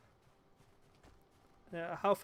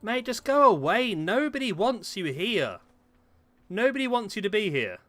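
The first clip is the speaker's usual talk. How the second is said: loud, higher yell; shouting; 8 semitones higher, 6 dB louder than usual. Real, not acted. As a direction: yell it to drive him off. Yelling at creature approaching.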